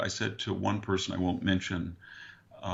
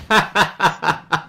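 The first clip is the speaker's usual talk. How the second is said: laughing; 9 semitones higher, 13 dB louder than usual. Real, not acted. Punchline of his prank.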